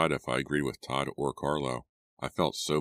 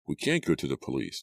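usual speaking voice

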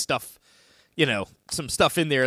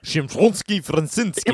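hammy fake Russian accent, higher and louder; bad Russian accent; 6 semitones higher, 5 dB louder than usual. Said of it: "It was a very bad Russian accent".

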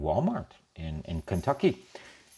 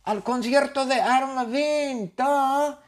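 high, insistent acted yell; shouting back. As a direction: holler a refusal back in a very high voice. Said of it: "Pablito le responde y le grita a Connor". (gritando en respuesta, muy agudo)

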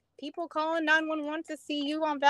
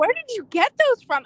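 much higher, louder, alarmed; shocked question; 10 semitones higher, 9 dB louder than usual. Retold or imitated. Officer confronting the man about stolen earrings, high and alarmed. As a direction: blurt it out, taken aback. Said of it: "I'm like".

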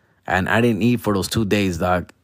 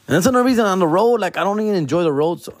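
fast high rant; 8 semitones higher, much faster than usual. Real, not acted.

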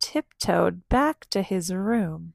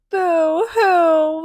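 loud wailing narration, crying sound on boo-hoo; 8 semitones higher, 10 dB louder than usual. A narrator's reading. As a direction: read it like a big loud sob breaking in. Loud crying noise in story.

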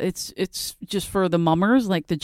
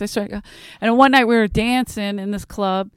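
ordinary delivery

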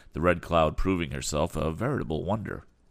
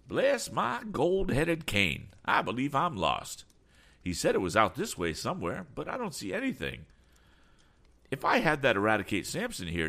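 flustered eccentric; 9 semitones higher than usual. Acted. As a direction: play a fussy, lost eccentric in a high voice. Voices flustered eccentric Mister Damon.

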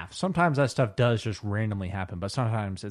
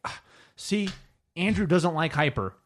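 high-pitched, louder mock accusation; mock online commenter; 9 semitones higher, 6 dB louder than usual. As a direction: imitate a tattling commenter, pitched up. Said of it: "someone replied to that, being like".

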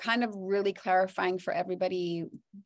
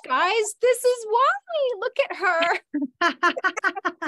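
high, loud, slow tear-choked voice; tearful crying; 10 semitones higher, 7 dB louder, much slower than usual. Real, not acted. Moved to tears by a friend's farewell, tearful high voice. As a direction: voice breaking as she starts to cry. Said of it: "I'm now crying".

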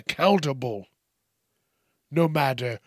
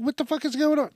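high panicked what-the-fuck, much higher in pitch; startled outburst; 13 semitones higher, much faster than usual. Retold or imitated. Spotting bees all around his car, startled high outburst. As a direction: blurt it out shocked, pitch jumping way up. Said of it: "I was like".

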